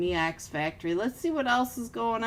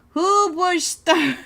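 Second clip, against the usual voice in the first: indignant shout; 9 semitones higher, 8 dB louder, slower than usual. Real, not acted.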